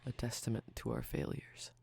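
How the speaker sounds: soft, intimate, fading to a whisper; sultry close-mic voice. Acted. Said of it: "my sultry speaking voice".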